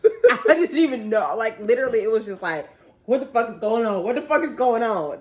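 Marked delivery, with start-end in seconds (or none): 3.0-5.2 louder, higher bewildered repeat; stunned inner panic. Retold or imitated. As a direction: say it like your mind is spinning. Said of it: "exactly what was going on in my head".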